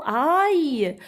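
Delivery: AÏE high and long, falling; sound demonstration. Shown as a demonstration. Teacher discovers the sound with children, drawn out. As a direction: stretch AÏE as a gliding "a-y". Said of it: "A I L se prononce".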